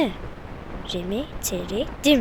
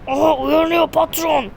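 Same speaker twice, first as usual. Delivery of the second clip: sly villain voice; 5 semitones higher, 6 dB louder than usual. Acted. Villain greets waking captive.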